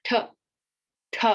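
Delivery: tongue on teeth, t; dental t sound. Shown as a demonstration. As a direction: dental t, not th. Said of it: "the T H is t".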